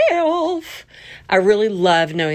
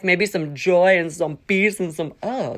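normal talking voice

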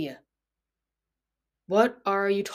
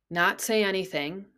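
ordinary talk, nothing marked

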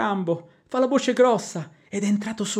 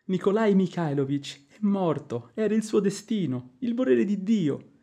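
ordinary talk, nothing marked

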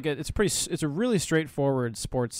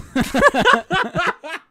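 loud high laugh; 11 dB louder than usual. Real, not acted. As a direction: burst of high-pitched laughter. Laughing at own joke.

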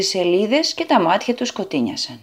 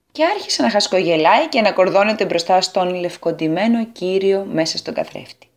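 ordinary delivery, nothing marked

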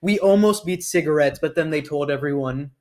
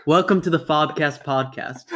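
normal talking voice

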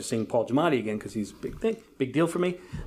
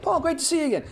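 much higher, beaming hello to a star; eager rehearsed greeting; faster than usual. Retold or imitated. Rehearsing his greeting to the star, much higher. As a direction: overeager hello practised in his head. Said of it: "I'm going to do my head".

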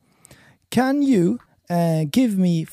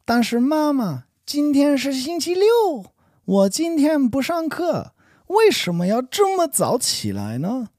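put-on higher pitched, whiny child protesting; kid voice; 10 semitones higher than usual. Son protesting to mum about getting up on Saturday, child voice. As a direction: play a young son complaining to mum. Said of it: "you sound like a kid".

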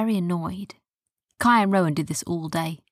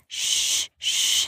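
hushing. A narrator's reading. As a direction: hush the bird with drawn breath. Hushing the parrot.

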